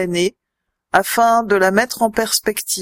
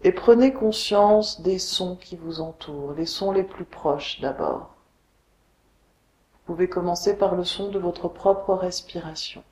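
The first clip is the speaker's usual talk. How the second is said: guided meditation; 3 semitones lower, 5 dB quieter than usual. Acted. Yoga teacher guiding listeners lying on the floor, meditation voice. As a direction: guide them unhurriedly into deep rest, letting phrases settle. Leads a Yoga Nidra relaxation.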